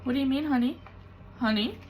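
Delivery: high, sing-song "honey"; lightly voiced local woman; sweet honey voice. Woman comforting her in the bathroom, sing-song sweet. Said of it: "they say honey a lot".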